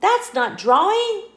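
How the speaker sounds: high, loud little protest; a small squirrel, puzzled and loud; squirrel objecting. Acted. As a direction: point out the mistake, puzzled.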